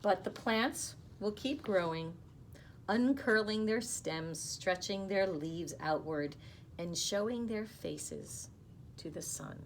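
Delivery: storybook reading voice, lively; gentle read-aloud to young listeners; reading to children. Acted. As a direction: read it to the class like a picture book. Teacher reads picture book aloud.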